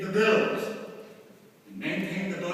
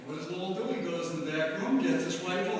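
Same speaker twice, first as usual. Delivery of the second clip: ordinary talk, nothing marked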